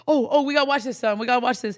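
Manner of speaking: high, loud excited coaxing; loud, excited dad talk; excited to the baby. Retold or imitated. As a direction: hype the baby up to watch. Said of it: "and I was like".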